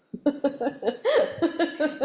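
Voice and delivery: high burst of laughter; real, delighted laughing; amused laugh. Real, not acted. Amused after the punchline.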